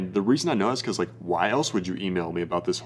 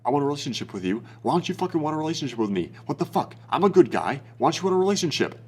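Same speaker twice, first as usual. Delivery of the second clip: hammed-up desperate plea; fast, high mock outburst at a woman; mock needy scream; 6 semitones higher than usual. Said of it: "that's basically screaming to her".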